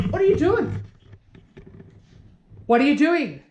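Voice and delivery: sharp high scolding at the dog. Real, not acted.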